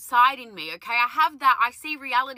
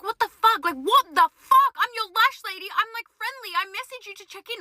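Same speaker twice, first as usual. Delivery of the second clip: indignant outburst; 8 semitones higher than usual. Retold or imitated. Retold outrage at cafe lady's rudeness.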